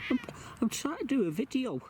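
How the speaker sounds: high, pleading whine; exasperated whine. Real, not acted. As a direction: whine at the cat in despair. Cat interrupting.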